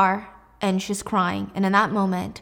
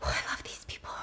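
delighted whisper; 14 dB quieter, faster than usual. Real, not acted. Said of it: "host reacts to drama".

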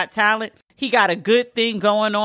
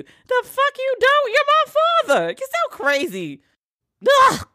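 much higher, slow indignant squeal; outraged daughter. Retold or imitated. Daughter shrieking at her father in disbelief, outraged squeal.

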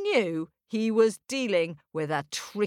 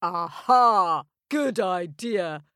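giant muttering; 5 dB louder, slower than usual. Acted. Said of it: "muttered the giant".

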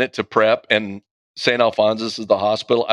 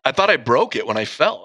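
normal talking voice

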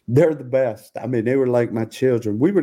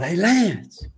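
retold sideline yell; 8 semitones higher than usual. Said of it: "And I yelled".

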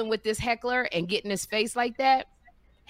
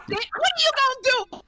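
very high, exasperated squeak; mock exasperation; 4 dB louder than usual. To stubborn friend Kim, squeaky exasperated question. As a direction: throw the question up high in disbelief.

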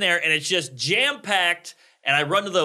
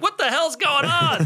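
freaking out; 10 semitones higher than usual. Retold or imitated. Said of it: "I just break down".

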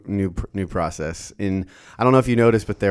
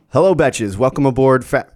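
higher, louder cabin greeting voice; flight attendant voice; 5 semitones higher, 7 dB louder than usual. Shown as a demonstration. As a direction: greet passengers like cabin crew. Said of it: "this is gonna be my, uh, flight attendant voice".

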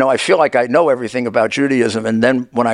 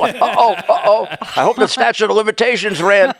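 higher-pitched, amused pretend worry; mock alarm; 7 semitones higher than usual. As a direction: play at being caught out, half laughing. Jokes he will be prosecuted.

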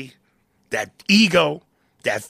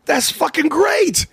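delighted; 4 dB louder, slower than usual. Real, not acted.

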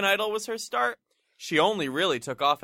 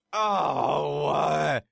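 annoyed; 3 semitones lower than usual. Retold or imitated. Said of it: "she was annoyed".

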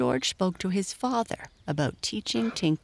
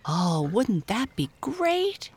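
high, drawn-out delight; excited exclamation; 6 semitones higher, slower than usual. A narrator's reading. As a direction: gush slowly like an eager girl. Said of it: "exclaimed Mabel".

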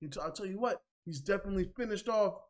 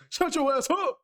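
lightly voiced Reggie Carr, higher and louder; 8 semitones higher, 6 dB louder than usual. Host scolding the rapper, in a light Reggie Carr voice. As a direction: bark it like Reggie Carr, a touch. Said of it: "Reggie Carr's voice".

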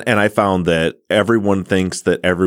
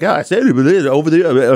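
higher, garbled imitation of an irritated clerk; mock annoyed; 8 semitones higher, 4 dB louder than usual. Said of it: "like, annoyed".

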